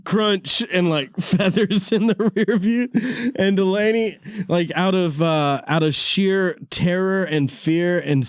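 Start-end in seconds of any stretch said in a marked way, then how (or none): none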